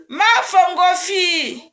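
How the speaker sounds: slow, loud, half-crying call; tearful excited cry. Real, not acted. Greeting her dear one on the live, tearful loud call. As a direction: cry out the name with joy and tears.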